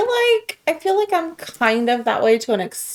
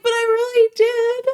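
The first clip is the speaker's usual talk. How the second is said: high mock whine; 11 semitones higher than usual. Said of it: "You're like".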